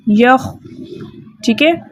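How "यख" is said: यख ending on a voiceless throat kh, no vowel after; stopped kh. Shown as a demonstration. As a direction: halt यख right on the kh friction. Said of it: "इसके मखरज में रोक देना है".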